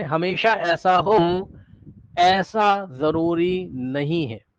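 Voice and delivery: drawn-out, word-by-word delivery; slow emphatic repeat. Real, not acted. Teacher spelling out a caveat to students, slow and deliberate. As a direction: stretch each word out very slowly.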